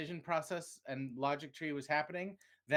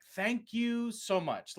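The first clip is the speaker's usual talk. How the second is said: sarcastic thanks; 8 semitones higher, 3 dB louder, much slower than usual. Retold or imitated. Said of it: "and I'm like".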